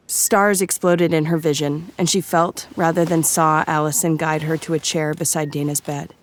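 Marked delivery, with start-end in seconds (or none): none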